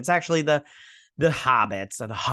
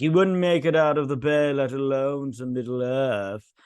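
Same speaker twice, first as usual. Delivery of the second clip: raised, drawn-out character voice; acted show character; 3 semitones higher, 3 dB louder than usual. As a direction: put on a character's voice, stretching the ending.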